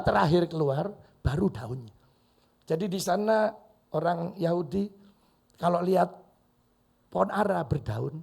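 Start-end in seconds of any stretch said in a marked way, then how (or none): none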